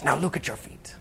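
intense whisper, imitating a friend; hushed passion. Friend tells new arrivals to look down, in an impassioned whisper. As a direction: whisper it with quiet fervor. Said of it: "he had such passion".

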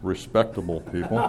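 ordinary delivery

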